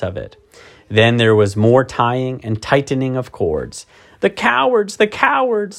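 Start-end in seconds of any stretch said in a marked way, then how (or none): 4.2-5.8 put-on high, tearful girl's lament; sobbing girl. A narrator's reading. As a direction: weep out the words. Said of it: "sobbed Susan".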